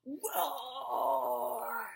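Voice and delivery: playful monster roar. Shown as a demonstration. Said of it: "do your terrible roar for me".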